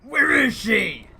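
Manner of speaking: slow, loud growled hero shout; Dark Knight voice. Pretend. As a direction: growl it out slowly like a caped vigilante. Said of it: "Oh, Dark Knight voice".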